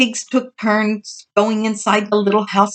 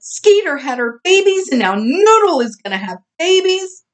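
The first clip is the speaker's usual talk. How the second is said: excited; 9 semitones higher than usual. A narrator's reading. Otis excitedly announcing.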